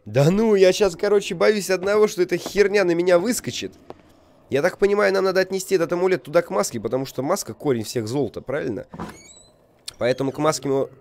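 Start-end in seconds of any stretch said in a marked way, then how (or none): 0.0-6.8 raised, nervous gamer voice; jittery, afraid something jumps out; panicking gamer. Real, not acted. Scared of the creature jumping out. (испуганный игрок)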